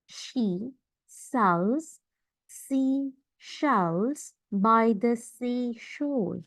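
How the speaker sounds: "She... sells... sea... shells", very slow with gaps; word-by-word demo. Shown as a demonstration. Teacher shows students the S and SH sounds, word-by-word demo.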